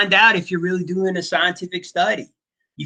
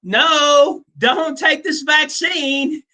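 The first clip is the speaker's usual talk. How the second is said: mock plea; 10 semitones higher, much slower than usual. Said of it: "they're like".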